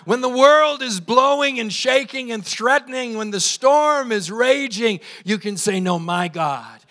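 projected, emphatic pulpit cadence; fervent preaching. Real, not acted. Pastor declaring an unchanging God, fervent preaching. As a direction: build a list toward a ringing cry.

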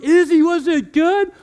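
high, loud laughing call; dad calling to daughter, thrilled; excited. Retold or imitated. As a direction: call out to her, delighted. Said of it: "I'm like".

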